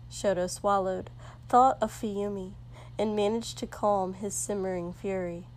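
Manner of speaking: ordinary delivery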